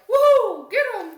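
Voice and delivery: high, loud whoop of a crowd; mock cheering. Other kids cheering on the fight, mock excited whooping. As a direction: whoop and egg on the fight, imitating.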